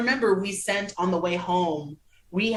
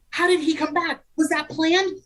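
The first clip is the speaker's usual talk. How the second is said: high, breathless-with-hype questions; re-enacted excited bewilderment; hyped-up disbelief; 8 semitones higher, 4 dB louder than usual. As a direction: fire off questions like an astonished fan. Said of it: "amazing hype of it all".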